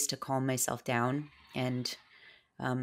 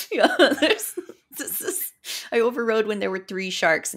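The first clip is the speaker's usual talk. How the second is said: louder, laughing through the words; real laughter breaking the sentence; 8 semitones higher, 8 dB louder than usual. Real, not acted. Laughing while recounting.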